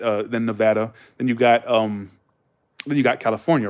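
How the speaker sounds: ordinary talk, nothing marked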